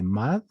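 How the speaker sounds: mad closed on the D, no vowel after; silent E. Shown as a demonstration. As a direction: cut mademoiselle to "mad", dropping the E. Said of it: "la E se vuelve muda". ("mad" con la E muda)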